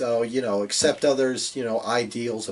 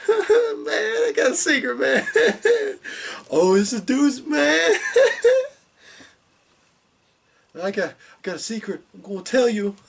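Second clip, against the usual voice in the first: very high, giggling creepy wrestler voice with laughs breaking through; high-pitched giggling impression, laughing through every line; high giggly Bray Wyatt; 13 semitones higher, 5 dB louder than usual. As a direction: squeal the secret out between giggles like a cackling stoned cult leader. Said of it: "my Bray Wyatt impression is high Bray Wyatt. He's got the giggles".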